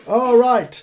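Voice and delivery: loud, high announcer's opener; announcer's call. Acted. Game master opens as ring interviewer, loud announcer's call.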